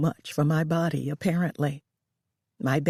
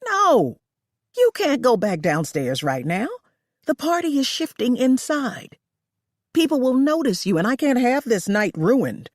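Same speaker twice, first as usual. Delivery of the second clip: higher and louder, forbidding; lightly voiced frantic hostess; 8 semitones higher, 4 dB louder than usual. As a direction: stop her before the party sees.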